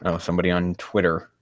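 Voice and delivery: usual speaking voice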